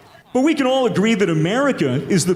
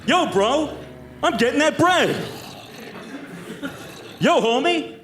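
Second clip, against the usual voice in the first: bro voice; 4 semitones higher than usual. Acted. Acted American youth slang.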